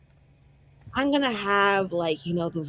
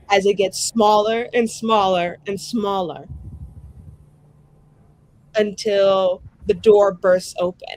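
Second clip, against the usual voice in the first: ominous scene narration; 5 dB louder than usual. Acted. GM describes Muriel's nightmare, ominous and building. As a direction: build the trap closing in around her.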